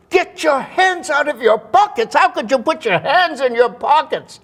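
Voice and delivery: high, loud imitated scolding; outraged actress's reprimand, mimicked at full pitch; Helen Hayes. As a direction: tell him off in a hammed-up, piercing voice. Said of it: "Helen Hayes".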